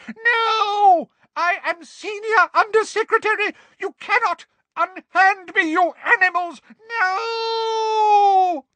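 very high, loud, outraged shrieking; Umbridge shrieking. Acted. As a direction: shriek in panic and outrage at the captors. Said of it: "he heard Umbridge shriek".

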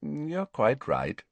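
put-on slow, serene old wizard; calm and unbothered. A narrator's reading. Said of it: "said Dumbledore serenely".

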